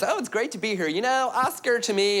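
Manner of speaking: high, gushy acceptance speech; mock awards-night gushing; gushing Oscar winner. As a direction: gush like a Hollywood star. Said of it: "like the Oscars and everyone's".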